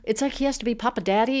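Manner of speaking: usual speaking voice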